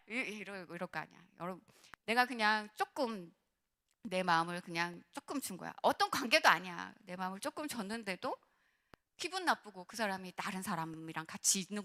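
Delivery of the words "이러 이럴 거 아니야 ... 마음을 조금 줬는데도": usual speaking voice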